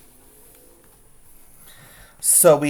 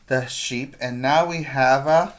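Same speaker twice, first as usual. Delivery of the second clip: usual speaking voice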